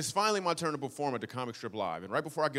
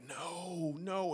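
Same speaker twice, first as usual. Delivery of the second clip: quiet dismay; 3 semitones higher, 6 dB quieter than usual. Retold or imitated. Dreading being bumped by the headliner.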